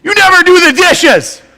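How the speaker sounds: high, loud mock wife voice; complaining wife. Wife scolding her husband about the dishes, high and loud. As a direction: squeal it out as the fed-up wife. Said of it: "and she said".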